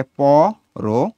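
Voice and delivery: "প" and "র" as two separate beats; syllable by syllable. Shown as a demonstration. Teacher counting syllables, "প" and "র" said separately. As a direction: break "পর" into detached syllables.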